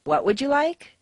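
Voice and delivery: "what would you like" climbing high to a rising end; asking for a repeat. Shown as a demonstration. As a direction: ask them to repeat it, "like" rising sharply. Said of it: "You didn't hear".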